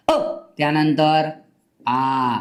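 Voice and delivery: अ short, आ drawn out slowly; teacher demo of अ versus आ; short then long vowel. Shown as a demonstration. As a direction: let आ stretch relaxed after a quick अ. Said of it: "थोडं निवांत म्हणायचं हा".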